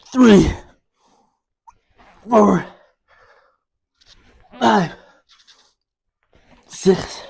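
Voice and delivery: loud, strained rep counting; straining effort. Real, not acted. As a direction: force each number out under load. Straining through heavy reps.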